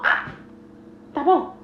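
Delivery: ordinary delivery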